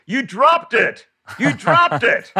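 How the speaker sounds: high-pitched imitated angry yell; shouting. Dentist shouting at a woman in the back room, high imitated yell. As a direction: re-enact a furious accusing shout, voice pushed way up. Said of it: "And then I hear shouting, screaming in back".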